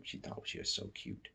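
hushed scornful aside; a hushed sneering thought; whispered scorn. Acted. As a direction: breathe it out with contempt. Ruler's scornful inner thought.

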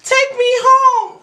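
high, loud command; high commanding call. Acted. Aladdin commanding genie.

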